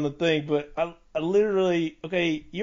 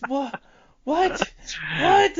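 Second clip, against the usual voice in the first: high-pitched, stunned repeated "what?"; mind blown; 12 semitones higher, much slower than usual. Retold or imitated. Retelling how it blew their mind, baffled squeaks. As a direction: replay disbelief, each one higher. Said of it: "it blew my mind".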